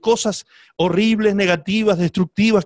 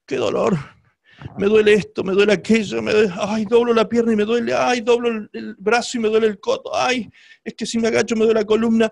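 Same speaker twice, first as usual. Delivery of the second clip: higher-pitched moaning about aches; whiny complaint; 4 semitones higher than usual. Acted. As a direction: imitate someone groaning over every little pain. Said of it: "nos levantamos diciendo".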